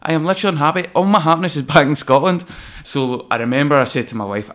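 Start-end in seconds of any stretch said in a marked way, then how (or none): 0.0-2.4 retold frustrated outburst. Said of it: "and I was like".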